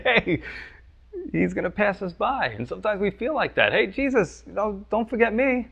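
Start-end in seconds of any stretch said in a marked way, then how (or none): 0.0-2.5 higher, anxious disciple calling out; a lightly voiced, worried fisherman. 3.7-5.7 higher, plaintive plea to Jesus; a lightly voiced, anxious prayer; pleading believer.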